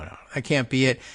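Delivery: ordinary delivery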